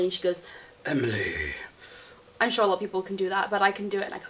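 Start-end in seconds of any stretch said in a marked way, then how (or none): 0.8-1.2 very low, dropped voice; deep voice. Shown as a demonstration. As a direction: drop the voice right down. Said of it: "really deep voice".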